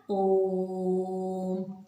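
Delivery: ओम held long and steady like a chant; drawn-out om. Shown as a demonstration. Teacher showing pupils a pluta vowel, long chanted om. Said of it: "उसमें जैसे खींचते हैं ना".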